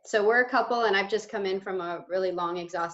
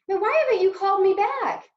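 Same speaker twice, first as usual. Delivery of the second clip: upset complaint; 9 semitones higher, 4 dB louder than usual. Acted. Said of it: "Do you want us to show that?".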